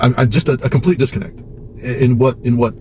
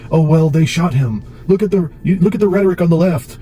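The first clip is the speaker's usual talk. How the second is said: high-pitched whiny mimicry of complainers; mock outrage; 7 semitones higher than usual. Mimicking Trump supporters' excuses, mock outrage. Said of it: "you don't get to say anymore".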